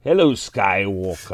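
low, sinister Emperor impression. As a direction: purr it like a Sith lord. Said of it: "the Emperor from Star Wars".

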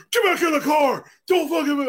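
high, loud barked police order; cops shouting. Retold or imitated. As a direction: yell it like a cop pulling a gun. Cops yelling at him, retold.

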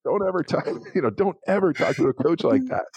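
high-pitched, emphatic mock telling-off; retold coach scolding. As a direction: imitate an outraged coach, amused. Retold coach dressing him down.

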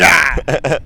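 short loud imitated yell; loud mock cry of exertion. Imitates nurse jabbing the vein.

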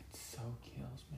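breathy whispered aside under her talk. Real, not acted. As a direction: say it under your breath, just for yourself. Co-host aside while partner talks.

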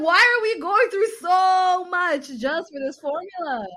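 high, loud, slow incredulous complaint; exasperated why. Retold or imitated. Asking why parents go through so much, high slow disbelief. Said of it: "I'm like".

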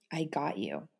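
low, soft, steady reassurance; tender reassurance. Retold or imitated. Husband calming his panicking wife, low and soft. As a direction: say it like holding someone close. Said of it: "the three words that Anthony would tell me".

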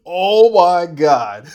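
loud, high, stretched-out outburst; real loud, long-held exclamation; drawn-out exclamation. Real, not acted. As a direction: belt it out slowly, way up in pitch. Choking moment, needs water.

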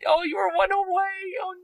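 high anguished wail over a near miss; anguished cry. Real, not acted. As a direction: wail like you just lost it all. Near-miss dice roll despair.

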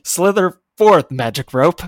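slow, loud grand spell command; mock incantation. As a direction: boom out the magic words like a wizard. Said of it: "and then I say".

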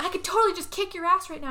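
quick, high-pitched acted threat; mock threat. Wrestling girl to the other, mock threat. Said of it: "they argue for a".

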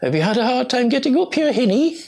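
high-pitched, badly faked Geordie; attempted Geordie accent. Site supervisor greeting a very old visitor, imitated Geordie accent. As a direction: have a go at a Geordie woman's lilt. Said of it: "I can't do her Geordie accent very well".

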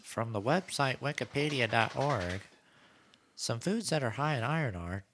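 high-pitched automated readout; Google assistant. Retold or imitated. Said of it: "Do they say it in that voice?".

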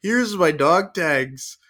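high-pitched, hammed-up last stand; mock heroic farewell. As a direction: play the doomed hero, pitched well up.

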